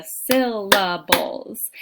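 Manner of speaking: SYLLABLES broken into beats, first one strongest; exaggerated syllable stress. Shown as a demonstration. Teacher claps out syllables for students, stressing SYL. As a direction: clap out SYL-la-bles, leaning on SYL. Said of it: "really exaggerate the stressed syllable".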